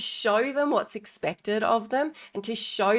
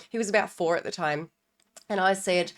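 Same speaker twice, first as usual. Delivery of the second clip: ordinary talk, nothing marked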